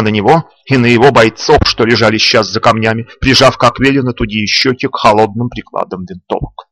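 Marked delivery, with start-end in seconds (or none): none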